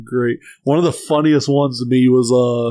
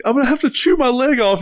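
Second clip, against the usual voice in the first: high-pitched mock panic; hammed-up cartoon distress; distressed gummy bear; 11 semitones higher, faster than usual. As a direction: plead in a high cartoon critter voice. Voices trapped gummy bear.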